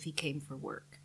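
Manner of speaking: ordinary delivery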